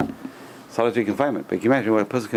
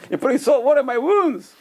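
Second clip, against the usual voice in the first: mock complaining rabbi; 4 dB louder than usual. Rabbi voiced complaining in story.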